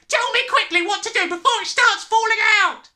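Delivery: very high, loud, panicked plea; wailing. A narrator's reading. Father begging for help before baldness, wailing. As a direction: beg at full wail. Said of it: "wailed the father".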